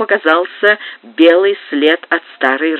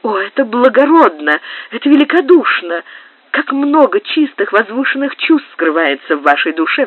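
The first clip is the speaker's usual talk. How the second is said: fervent exclamation; 8 semitones higher than usual. A narrator's reading. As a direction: gush with admiration. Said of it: "вскричала с жаром графиня". (с жаром, высоко и громко)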